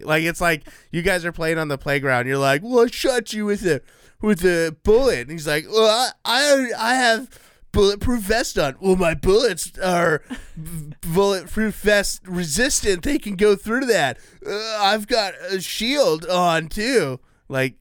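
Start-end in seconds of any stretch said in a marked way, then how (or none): 2.6-5.2 higher, louder mock child bragging; playground kid. Childish playground quarrel imitated. 5.7-8.8 higher, louder mock child bragging; playground kid. Childish playground quarrel imitated. 8.8-17.2 put-on playground kid. Childish playground quarrel imitated.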